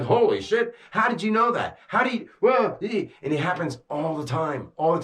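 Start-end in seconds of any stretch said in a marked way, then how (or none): 0.0-3.1 pitched up, stunned, holy shit disbelief; amazed gasp. Retold or imitated. Talking to the camera, retelling people's stunned reaction, pitched-up amazement. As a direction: act floored by what you just heard. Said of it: "I'm like".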